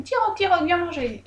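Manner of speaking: high lilting call to a pet fish. Retold or imitated. Jong calling her fish at the pond, lilting and high. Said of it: "elle fait".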